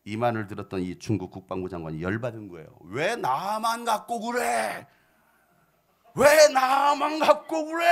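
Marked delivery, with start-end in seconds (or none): 2.8-7.8 slow sulky grumble building to a loud, high angry shout; angry whine to shout. Retold or imitated. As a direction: start as a sulky complaint, end yelling it. Naaman angered, whining complaint.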